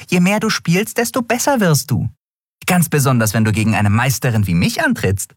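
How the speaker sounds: high-pitched little master, boasting; cocky Nelen. Acted. Nelen boasts to Miko about practice, high and cocky. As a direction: lecture him proudly in a high voice.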